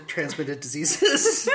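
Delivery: laughing. Real, not acted. Laughing at the pun.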